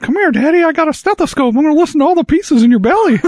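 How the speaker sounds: very high little elf voice; Alfie voice. Retold or imitated. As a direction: play a tiny squeaky child-elf. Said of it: "He's like".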